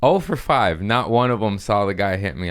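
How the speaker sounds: normal talking voice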